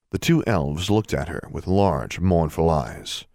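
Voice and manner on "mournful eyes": ordinary delivery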